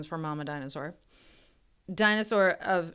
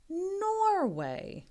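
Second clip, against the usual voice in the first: surprise reveal; 12 semitones higher than usual. Acted. Surprise twist in reading.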